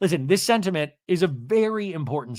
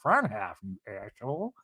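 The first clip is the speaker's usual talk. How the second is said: low, soft mock retort; underplayed imitated comeback; Meredith imitation; 3 semitones lower, 6 dB quieter than usual. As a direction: swear under the voice, low and quiet. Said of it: "Mary's like".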